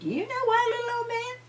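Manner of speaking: put-on high, lilting old lady voice; sweet old lady. A narrator's reading. Old lady fondly tells her husband, high and sweet. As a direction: lift it up sweetly like a fond old wife. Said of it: "the little old lady said".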